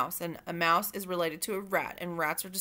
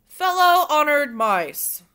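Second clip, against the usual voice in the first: high, loud, slow formal address; slow, loud, ceremonious call to order; pompous head mouse; 6 semitones higher, 11 dB louder, slower than usual. Acted. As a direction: open the meeting grandly. Head mouse opens the council.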